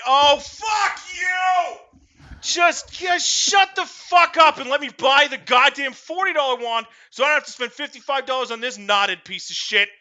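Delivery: unload on the shop lady in hammed-up rage; imagined furious outburst. Telling off the wand shop lady, loud high mock fury.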